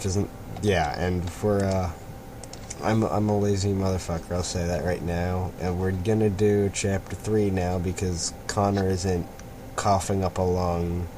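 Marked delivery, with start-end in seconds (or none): none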